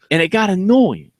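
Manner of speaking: ordinary talk, nothing marked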